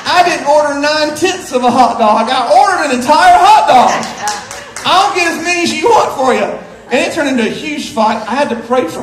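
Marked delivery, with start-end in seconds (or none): 0.0-6.5 comic outraged husband, voice climbing; mock exasperation. Husband arguing with wife over his hot dog, mock outrage. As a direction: build to a loud, high protest.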